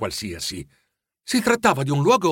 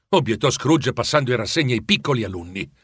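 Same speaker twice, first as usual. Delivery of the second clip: usual speaking voice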